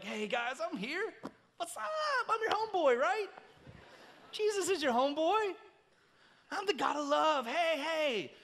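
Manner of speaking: high, goofy homeboy greeting; mock laid-back God. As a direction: send up a God who's everybody's buddy. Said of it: "I'm the laid-back God".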